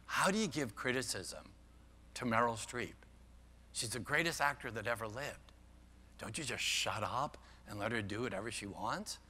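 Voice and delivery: softly quoting an interviewer's challenge; quiet retold question. Quotes interviewer questioning Nora Ephron.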